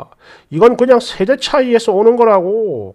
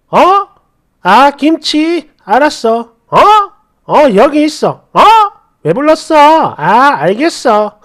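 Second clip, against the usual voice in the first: annoyed retort; 4 semitones higher than usual. Retold or imitated. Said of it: "짜증이 나서".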